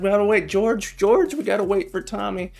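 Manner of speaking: mom tomato voice. Acted. Scene: mama tomato begging dad to wait for baby, high and urgent. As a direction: squeak out mama tomato's worry. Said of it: "Mom's like".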